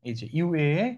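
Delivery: usual speaking voice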